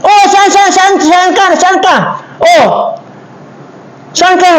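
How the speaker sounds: high-pitched frantic calling; frantic search. Acted. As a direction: call out in a panic.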